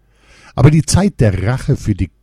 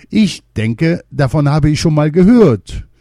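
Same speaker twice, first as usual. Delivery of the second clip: higher, louder forgetful repeat; king repeating; 8 semitones higher, 6 dB louder than usual. A narrator's reading. Poisoned king repeating his sentence, higher and louder. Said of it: "wiederholte der vergiftete König wieder".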